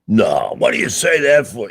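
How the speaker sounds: put-on loud New York accent. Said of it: "in his thick New York accent".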